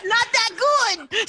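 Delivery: high, loud, fast laughing blurt. Real, not acted.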